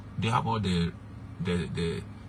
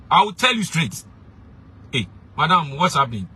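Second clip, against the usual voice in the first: loud confrontation; 6 semitones higher, 7 dB louder than usual. Acted. Preacher voicing confronting husband.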